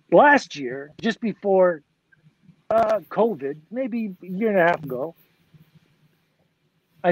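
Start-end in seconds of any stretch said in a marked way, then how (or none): none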